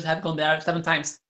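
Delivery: ordinary delivery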